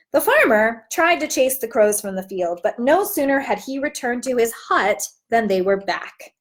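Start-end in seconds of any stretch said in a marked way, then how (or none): none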